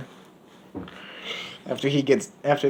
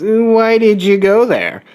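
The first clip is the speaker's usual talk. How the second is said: higher, louder, slow woman's voice; her puzzled question; 9 semitones higher, 4 dB louder, slower than usual. Acted. She questions him about going there, higher and slower. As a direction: ask it slowly in her higher voice.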